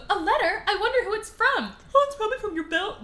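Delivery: high, hurried put-on heroine voice; mock Belle. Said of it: "do that again so I don't sound stupid".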